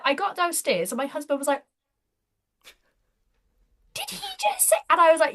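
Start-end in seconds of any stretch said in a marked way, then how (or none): 3.9-4.8 much higher, disbelieving half-question; incredulous. Retold or imitated. Husband asking his wife about the fitter, high incredulous question. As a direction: catch the words in stunned disbelief.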